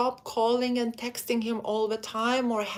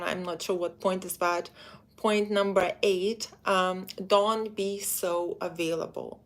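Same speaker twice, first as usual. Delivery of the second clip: ordinary talk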